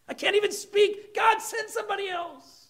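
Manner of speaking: desperate Moses. Retold or imitated. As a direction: plead with God to send someone else. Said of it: "Moses was so focused on himself".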